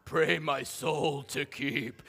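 slow, low, soft eerie prayer; creepy voice. Shown as a demonstration. Pastor to congregation, slow creepy prayer. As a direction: drag it out like a haunted bedtime prayer. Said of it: "just put a creepy voice to that whole thing".

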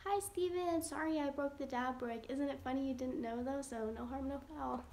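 ordinary talk, nothing marked

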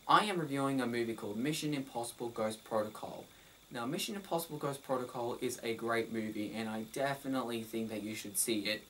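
soft, flat-lined, no energy at all; bored monotone. Shown as a demonstration. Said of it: "If I spoke like that".

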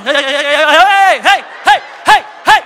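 loud, high repeated hey, slow at first; mock ecstatic shouting. Woman in prayer thinking the Holy Spirit came on her, shouting. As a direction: shout as if the Spirit is coming on you. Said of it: "the woman starts going".

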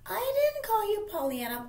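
high mocking falsetto; exaggerated girly voice denying it; mean girl's denial. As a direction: imitate her squeaky, innocent protest.

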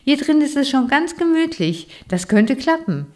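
a tiny bookworm thrilled by his cozy cave; delighted bookworm. A narrator's reading.

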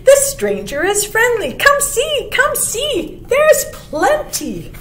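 high, piping, inviting bird voice; friendly chickadee. A narrator's reading. Chickadee calling the others to come see, high and inviting. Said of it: "said the chickadee".

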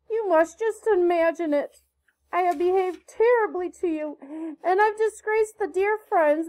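put-on high, impassioned pleading of a penitent girl; beseeching apology. A narrator's reading. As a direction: beg forgiveness with theatrical remorse. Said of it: "held out her hands beseechingly".

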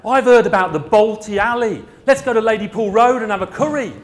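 gung-ho salesman keen for a curry; sales rep. Retold or imitated. Sales rep urging colleagues to the curry house, loud and eager. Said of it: "your sales force go".

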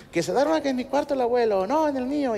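put-on high-pitched squabbling kids; pleading children. Children want grandfather in their room, pleading.

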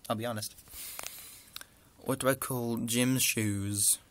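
ordinary talk

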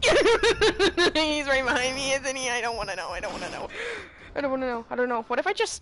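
loud, high panicked screaming; panicking gamer. Real, not acted. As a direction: scream as the monster chases you. Chased by the enemy in game.

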